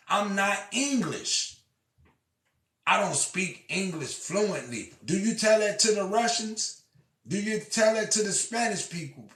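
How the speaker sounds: loud, high and angry; heated rant. Real, not acted. Ranting at listeners about their unfair standards, loud and high. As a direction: push it like a furious preacher.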